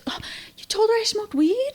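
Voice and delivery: high, hushed mock shock; caught off guard. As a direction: play a high-pitched, startled whisper-like protest. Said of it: "totally caught off guard".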